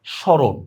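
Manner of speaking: স্মরণ as "shoron", no m; silent m-phala. Shown as a demonstration. Teacher shows students the rule, স্মরণ with silent m. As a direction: drop the m in স্মরণ. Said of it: "মফলার কোনো উচ্চারণ নাই".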